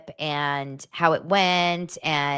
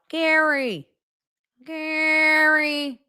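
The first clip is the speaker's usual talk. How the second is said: high, drawn-out Gary; ominous reveal; 12 semitones higher, much slower than usual. Real, not acted. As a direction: stretch the name like a dark reveal.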